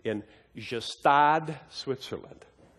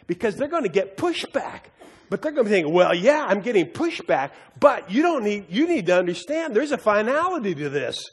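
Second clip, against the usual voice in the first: raised, higher preaching voice, building; urgent, louder sermon delivery; 8 semitones higher, 5 dB louder than usual. Acted. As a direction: preach it out with fervour. Preaching to the congregation.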